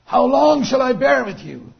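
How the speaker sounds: loud, high cry of exasperated disappointment; God lamenting over his people, acted out; disappointed lament. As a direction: draw it out slowly, pleading with wayward people. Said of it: "the heart of God being disappointed".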